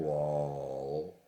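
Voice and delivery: low, long-held "lol"; mocking lol. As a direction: draw the word out with dull scorn. Mocking the written word lol.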